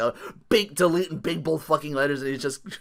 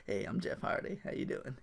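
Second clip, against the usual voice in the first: softer, half-whispered mellow greeting; laid-back drawl; 11 dB quieter than usual. Retold or imitated. Mimicking Jeff Hardy greeting fans, soft mellow drawl. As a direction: ease into it, cool and sleepy. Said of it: "make him sound like Matthew McConaughey".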